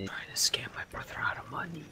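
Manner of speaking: sly whispered aside. Real, not acted. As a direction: whisper it behind your hand. Muttered aside during party banter.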